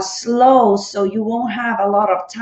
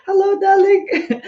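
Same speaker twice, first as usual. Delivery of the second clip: laughing greeting; 9 semitones higher than usual. Real, not acted. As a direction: greet her through a laugh, higher.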